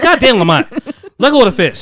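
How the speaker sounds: mock-exasperated nurse snapping at a patient. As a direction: scold him to let go. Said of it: "She's like, she's like".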